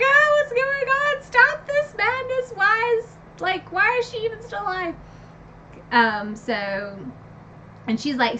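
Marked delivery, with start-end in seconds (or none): 0.0-4.9 a much higher, louder, hammed-up shriek; mock screaming. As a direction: cry out in horror at the page. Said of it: "screaming 'cause I'm like".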